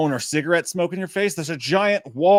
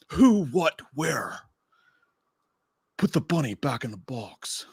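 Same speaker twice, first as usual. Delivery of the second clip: bad Nicolas Cage; slower than usual. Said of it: "That was that terrible Nicolas Cage impression".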